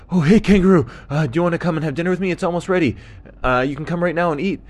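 high, quick dingo voice; excited high-pitched rush; friendly dingo, inviting. Acted. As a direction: call out an eager invitation.

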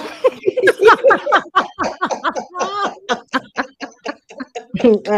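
long, high cackling laugh; burst of laughter. Real, not acted. Listeners cracking up at "assume they're dead", bursting laughter. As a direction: crack up loudly and keep it going.

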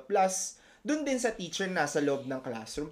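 usual speaking voice

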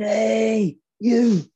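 put-on screaming Scottish manager accent. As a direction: bark it across at the other bench in a Scottish accent. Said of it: "And he's going in a Scottish accent".

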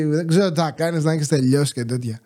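mocking snooty tone. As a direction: parrot her haughty order back with a sneer. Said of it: "Με τέτοιο υφάκι". (κοροϊδευτικό υφάκι)

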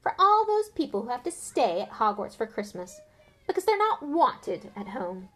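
Malfoy taunting Harry; sneering Malfoy. Acted. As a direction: sneer with fake pity.

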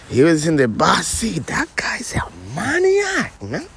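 hushed gossiping whisper. Acted. Whispering to his partner about reckless Sammy. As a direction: lean in and whisper the secret.

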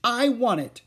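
put-on high, loud child's demand; a narrated tantrum, not full; whiny child shout. A narrator's reading. Ramona shouting at Wayne for the lollipop. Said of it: "screamed Ramona".

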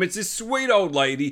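normal talking voice

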